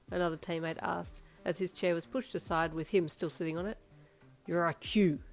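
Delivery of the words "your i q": annoyed. Acted. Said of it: "Darby shot back with annoyance".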